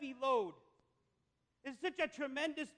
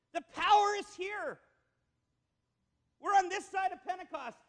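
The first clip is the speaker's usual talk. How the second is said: higher, louder proclaiming, slowing on the key words; fiery preaching; 5 semitones higher, 5 dB louder than usual. Real, not acted. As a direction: preach it to the rafters. Preaching builds to climax.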